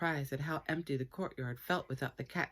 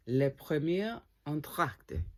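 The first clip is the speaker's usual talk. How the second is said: slow, careful mock-French pronunciation; attempted French; 5 dB louder, slower than usual. Real, not acted. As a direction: try a French accent. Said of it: "I don't really speak French".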